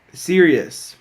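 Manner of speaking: serious pushed up high and loud; insistent. Retold or imitated. Romeo insisting to the nurse he means it. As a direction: lean hard on serious. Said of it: "trying to backtrack".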